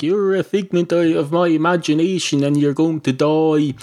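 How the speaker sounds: replayed line. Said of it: "if I'm saying stuff like".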